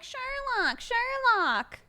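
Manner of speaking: high sing-song call of the name; imitating everybody calling. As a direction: call him like excited fans. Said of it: "Everybody's like".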